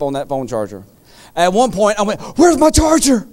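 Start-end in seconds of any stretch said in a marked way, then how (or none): 2.4-3.3 higher, anxious retold cry; lightly hammed-up worry; mock panicked question. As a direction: play your own frantic dream self. Panicked search in the dream.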